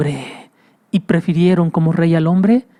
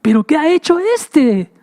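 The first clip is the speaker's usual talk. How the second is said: Pilate wondering; 9 semitones higher than usual. Acted. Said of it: "pensaba Pilato".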